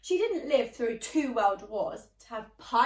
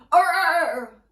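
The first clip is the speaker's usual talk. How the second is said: pirate shout; 6 semitones higher, 15 dB louder than usual. Acted. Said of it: "He likes to shout".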